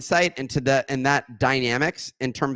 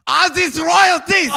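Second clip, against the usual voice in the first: mock Belgian dad, higher and louder, x0.7; a hammed-up impression of his Belgian father; imitating father; 8 dB louder than usual. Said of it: "my father would say".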